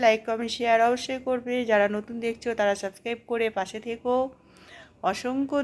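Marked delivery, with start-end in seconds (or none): none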